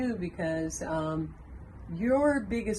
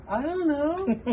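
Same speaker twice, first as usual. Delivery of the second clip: put-on high, louder panicky child's answer; the boy getting more scared each time; scared little boy; 10 semitones higher, 4 dB louder, slower than usual.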